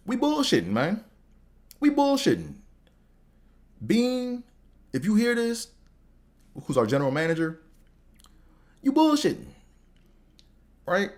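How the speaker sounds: exaggerated high complaint. Mock complaint about his team.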